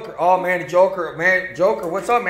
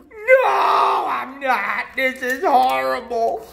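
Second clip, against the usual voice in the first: high, crying complaint, much higher; whiny hurt referee; 9 semitones higher than usual. Acted. Referee whining at the racers who keep crashing into him. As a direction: wail like a toy referee sick of being hit.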